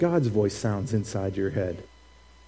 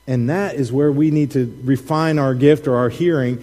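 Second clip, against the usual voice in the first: usual speaking voice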